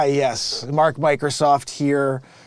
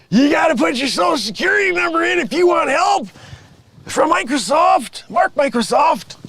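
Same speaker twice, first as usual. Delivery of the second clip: exasperated; 10 semitones higher than usual. Acted. Mark loses patience with Judy, high rushed exasperation. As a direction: pitch up fast in frustration.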